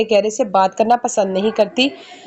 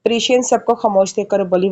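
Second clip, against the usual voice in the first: ordinary delivery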